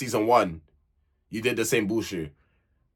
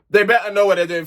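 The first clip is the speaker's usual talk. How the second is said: louder and higher, pressing the warning home; emphatic warning; 6 semitones higher, 7 dB louder than usual. Real, not acted. Vlogger warning Kaz and Luca, louder emphatic repeat. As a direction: say it again like a stern big sister.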